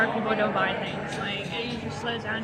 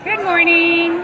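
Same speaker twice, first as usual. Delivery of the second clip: cheerful greeting; 6 semitones higher, 11 dB louder, slower than usual. Real, not acted. Greeting a customer at market.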